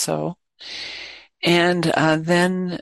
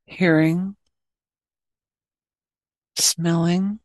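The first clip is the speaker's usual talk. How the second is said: guided meditation. Acted. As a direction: guide listeners gently into awareness, leaving plenty of space.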